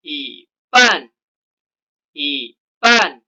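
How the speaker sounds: "ipan" with yi in a rising second tone; Mandarin tone change. Shown as a demonstration. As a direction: model "ipan" with a rising first syllable. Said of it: "Kita baca bersama-sama".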